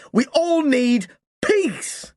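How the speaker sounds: slow, high, crying voice; boy ending rant near tears; tearful plea. A narrator's reading. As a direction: let the voice crack into tears. Voice breaks after long outburst.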